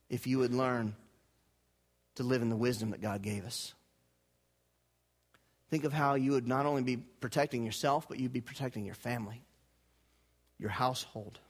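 hushed sincere appeal to the congregation; intimate appeal. Acted. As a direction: draw them in gently, almost confiding.

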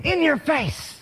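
high-pitched mock yell, no real volume; taunting yell. Preacher acts out yelling at Satan, high taunting mock yell. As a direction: crow it like a triumphant taunt. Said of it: "And I yell at Satan".

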